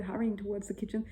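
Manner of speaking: ordinary delivery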